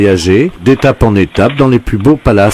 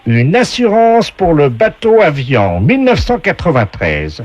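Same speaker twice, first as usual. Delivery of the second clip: game show voice; 4 semitones higher than usual. Acted. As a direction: announce it like a TV game voice. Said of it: "la voix du jeu".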